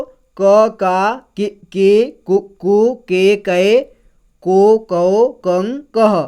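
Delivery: each syllable क, का, कि said apart and clearly; syllable drill. Shown as a demonstration. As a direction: spell out क to कह one by one, teacher style. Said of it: "तो हमने कैसे पढ़ेंगे इसको".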